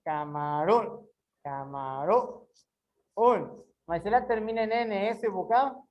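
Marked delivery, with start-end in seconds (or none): 0.1-1.1 "Camarão" slow and low, rising onto "rão"; drawn-out "Camarão" lifting at the end; stress on last syllable. Shown as a demonstration. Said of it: "o acento mais forte aonde?". 1.4-2.5 "Camarão" slow and low, rising onto "rão"; drawn-out "Camarão" lifting at the end; stress on last syllable. Shown as a demonstration. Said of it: "o acento mais forte aonde?".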